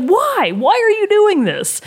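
mock despairing question; exasperated plea. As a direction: throw up your hands and plead with the troll. Exasperated at trolls.

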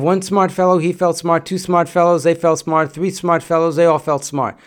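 brisk recitation; quick. Shown as a demonstration. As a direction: rattle it off at normal speed. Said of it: "When you say it quickly".

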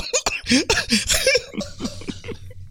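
high wheezing laugh after spitting water; helpless laughter. Real, not acted. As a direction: laugh so hard you can barely breathe. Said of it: "You okay?".

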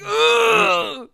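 loud, high groan of dismay; horrified groan. Retold or imitated. Bard hearing his captor's plan, loud horrified groan. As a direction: groan aloud in exaggerated revulsion. Retold disgust at the explanation.

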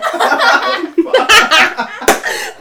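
loud, high burst of laughter; real fit of panicky laughing; nervous laughter. Real, not acted. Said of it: "even the laughter is fear".

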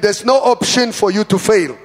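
loud, forceful declaration; booming pulpit insistence; like you mean it. Shown as a demonstration. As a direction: proclaim it with full conviction. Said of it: "Say it like you mean it".

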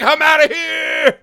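high slow shout. Pretend. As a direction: yell it while running off. Acted fleeing line.

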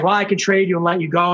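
usual speaking voice

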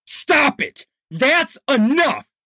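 high forceful shout; shouted command. Acted. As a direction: stop the fight cold. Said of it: "volume and intensity of his voice".

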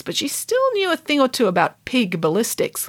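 usual speaking voice

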